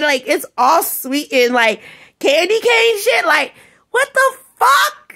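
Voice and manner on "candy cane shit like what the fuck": high-pitched, incredulous anger; real exasperation, climbing high and drawn out at the end; exasperated outburst. Real, not acted. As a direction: let the pitch leap in outrage. Angry rant at the people accused.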